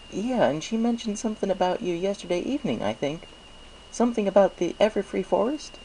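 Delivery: high, friendly shopkeeper pony voice; chirpy baker pleased with business; Mister Cake's voice. A narrator's reading. Baker thanks Applejack for the apples, chirpy and friendly. Mister Cake asks about Pinkie.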